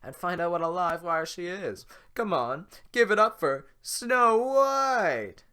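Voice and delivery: loud, slowing announcer build; talk show host. Acted. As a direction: hype the big reveal. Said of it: "sounds like a talk show host".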